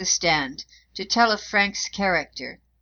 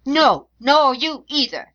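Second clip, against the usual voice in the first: higher, louder, slow and indignant; crying out in anger; 6 semitones higher, 7 dB louder, slower than usual. A narrator's reading. Defendant's mother to the solicitor, an outraged cry. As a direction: fling it back at him, outraged. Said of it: "she cried".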